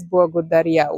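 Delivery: благодаря with the L said like a W, "boagodaria"; благодаря, L rounded to W; Bulgarian W-like L. Shown as a demonstration. Student points out the L in благодаря sounds like a W. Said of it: "You say it more like W".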